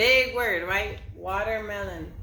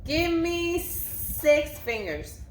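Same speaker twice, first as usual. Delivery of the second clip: slow teacher call; 7 semitones higher, much slower than usual. Shown as a demonstration. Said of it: "teacher drawing out a command".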